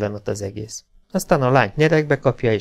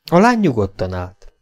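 plain reading voice

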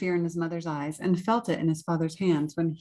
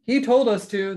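louder, higher storybook protest; protesting cry; 4 semitones higher, 6 dB louder than usual. A narrator's reading. Knights pointing to the king, crying out. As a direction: cry out, passing the blame. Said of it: "they cried".

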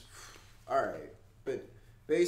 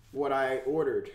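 normal talking voice

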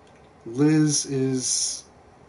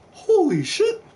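high-pitched startled yelp; real surprise, pitch shooting way up; startled exclamation; 4 dB louder, slower than usual. Real, not acted. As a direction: blurt it out, genuinely shocked. Sudden surprise in game.

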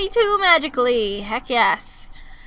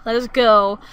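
ordinary delivery

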